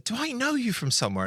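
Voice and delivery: a guy's question voiced very high; lightly imitating a curious stranger; stranger's question. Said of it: "a guy walked up to me and said".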